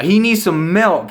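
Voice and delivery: high, loud, stretched holler; exaggerated comic cry; hammed-up catchphrase. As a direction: holler it slowly for comic effect.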